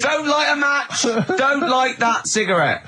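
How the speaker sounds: high, slow, hammed-up warning; mock warning. Joking host gives a hammed-up slow safety warning.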